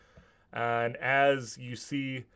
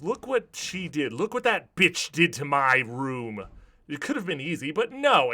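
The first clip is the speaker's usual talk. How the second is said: higher, louder villainous rant; enraged villain; 4 semitones higher, 6 dB louder than usual. Acted. Bertram shows Alfred the wrecked bedchamber, enraged. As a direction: rage upward to a loud refusal. Villain rages about his room.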